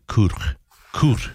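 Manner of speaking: COOT with a throaty ch at the end; guttural Welsh attempt. Shown as a demonstration. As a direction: rasp the ending of COOT as in loch. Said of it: "might be more like".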